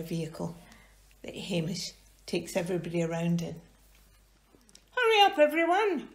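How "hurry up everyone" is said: high hammed-up call; high playful shout; mock shout. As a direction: call everyone to hurry. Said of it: "Hamish shouted".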